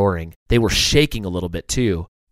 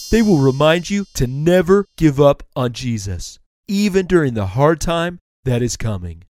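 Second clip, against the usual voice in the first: higher-voiced turtle mentor; kindly turtle, encouraging; 5 semitones higher than usual. Turtle hands stickers to the ants, encouraging. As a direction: encourage them with emphasis.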